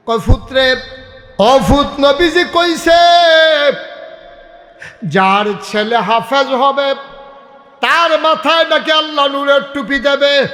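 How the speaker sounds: high ringing sermon cry; full-force preaching. Acted. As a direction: belt it out to the crowd, stretching the phrases.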